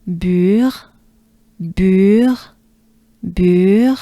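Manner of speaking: "Bure" drawn out, breathy French R ending. Shown as a demonstration. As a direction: join rounded u to breathed R in "Bure". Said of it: "Take a good breath with me".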